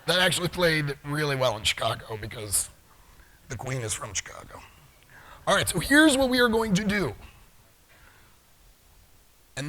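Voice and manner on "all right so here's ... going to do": high, loud call to the room; loud, high announcement to the audience; rallying call. Real, not acted. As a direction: grab the whole room's attention. Calling room to attention.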